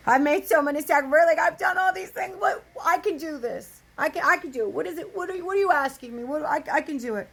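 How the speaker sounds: loud, high, rushed pleading; acted frantic insistence that you can handle it; insistent protest. Acted protest to God about sacrifice.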